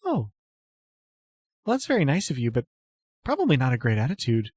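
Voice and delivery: put-on a timid goddess-follower greeting an old friend; timid noble lady.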